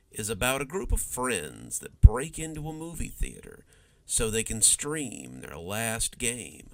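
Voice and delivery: slow, hushed horror-host segue; creepy anthology host voice; ominous host narration. Acted. Host's ominous segue between stories.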